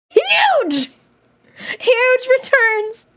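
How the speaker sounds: very high, stretched squeal; excited squeal. Real, not acted. Streamer celebrating chat's big win, high squeal. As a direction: squeal the word out in delight.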